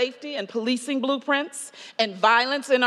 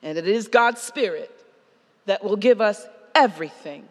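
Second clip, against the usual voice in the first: earnest preaching; 3 semitones lower than usual. Acted. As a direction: speak blessing gently over the congregation. Preaching conclusion, soft and earnest.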